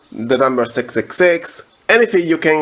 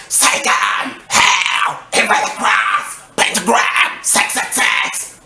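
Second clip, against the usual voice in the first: raspy held-back scream; 5 semitones higher, slower than usual. Shown as a demonstration. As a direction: rasp it out like an amateur screamer. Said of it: "hold back your scream so it sounds raspy, like this".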